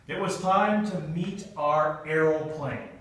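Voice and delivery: ordinary delivery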